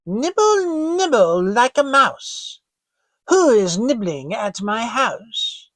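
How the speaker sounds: high thin witch voice, slowing at the end; a high, thin voice calling out from inside the house. Acted. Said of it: "heard a thin voice call out".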